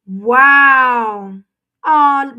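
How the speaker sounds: loud, choked-up wow; tearful wow. Real, not acted. Reader overwhelmed by a viewer's good news, near tears. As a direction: let the wow break with emotion.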